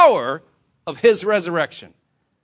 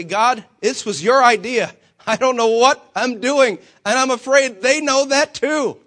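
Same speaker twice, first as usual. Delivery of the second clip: raised, louder mock complaint to God; acted desperate plea; 4 semitones higher, 6 dB louder than usual. Preacher confessing to God he is lost, acted desperation. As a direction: plead upward, building higher and louder.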